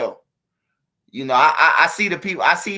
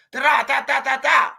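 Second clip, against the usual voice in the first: rapid, loud, higher da-da-da of an automatic; gunfire imitation; 8 semitones higher, 5 dB louder than usual. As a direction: rattle it off like gunfire.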